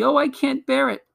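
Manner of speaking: put-on high-pitched girlish wail; distressed child. A narrator's reading. Lucy feels the magic drag her, distressed. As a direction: plead that it is too much. Lucy cannot bear the pulling.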